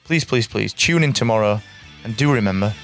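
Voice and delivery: ordinary delivery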